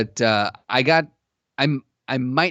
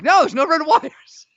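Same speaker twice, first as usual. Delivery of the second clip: exasperated, high-pitched insistence; freaking out; 13 semitones higher, slower than usual. Retold or imitated. Player retelling a bomb-game argument, freaking out. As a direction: insist in a high, drawn-out squeal. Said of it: "Sam freaking out at me".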